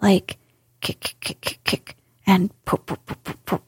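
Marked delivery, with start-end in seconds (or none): 0.8-2.0 breathy unvoiced kicks, soft and clipped; a whispered kick kick like a snort held in; stifled kicking noises. A narrator's reading. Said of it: "making strange, suppressed noises inside of him". 2.9-3.6 soft unvoiced pops like soda bottles; breathy little pops, held down; a suppressed pop pop pop under the breath; stifled pops. A narrator's reading. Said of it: "sounds resembling stifled snorts".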